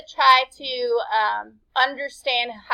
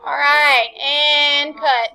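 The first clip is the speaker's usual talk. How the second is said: louder call to the class; 3 semitones higher, 7 dB louder, much slower than usual. Real, not acted. Teacher ending the class's partner talk, calling out. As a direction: call time over the chatter, louder.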